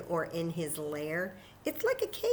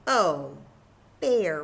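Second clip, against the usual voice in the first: squeaky mouse; 9 dB louder than usual. Acted. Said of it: "Mouse squeaks".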